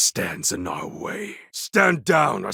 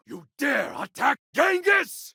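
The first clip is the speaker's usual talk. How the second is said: shouted, much higher, outraged; a warlord's battle shout; furious challenge. Acted. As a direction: roar the challenge at the attacker.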